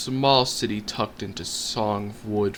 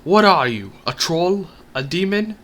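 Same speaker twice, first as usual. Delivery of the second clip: loud, higher frightened shout; shouting back; 7 semitones higher, 6 dB louder than usual. Acted. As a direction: yell it back across the woods. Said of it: "I shouted back".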